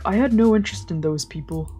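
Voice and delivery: ordinary talk, nothing marked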